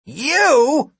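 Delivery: high startled yell. Acted. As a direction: shout it in shock. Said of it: "Gary yelled".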